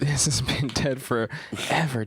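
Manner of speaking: slow, drawn-out mock whisper; whispered verdict. Echoing the doctor's verdict on the smell, slow whisper. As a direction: whisper it slowly as a joke diagnosis.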